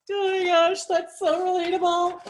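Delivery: high, loud, gushing reaction; gushing excitement. Retold or imitated. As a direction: squeal it like it hits home. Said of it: "I'm like".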